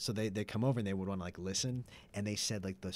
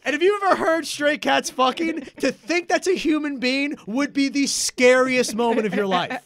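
excited; 13 semitones higher, 12 dB louder than usual. Real, not acted. Laughing disbelief continues.

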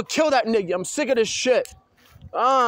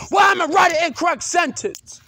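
frustrated shout at the work; angry outburst; 5 semitones higher, 4 dB louder than usual. Real, not acted. Upset over schoolwork, yelling in frustration. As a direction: yell out in real frustration.

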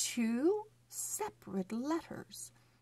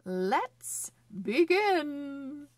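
pheasant announcer; 6 semitones higher, 4 dB louder, much slower than usual. Acted. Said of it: "picks up the microphone to say".

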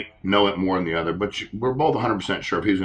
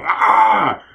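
sudden loud mock yell of road rage, high and loud; mock angry yell; 13 semitones higher, 7 dB louder than usual. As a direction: blurt it like a furious teen driver. Mock rage at honking driver.

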